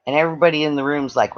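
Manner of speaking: ordinary talk, nothing marked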